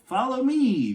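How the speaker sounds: bright, lifted call to the dog; eager call. A narrator's reading. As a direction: call him along eagerly. Character calls to dog.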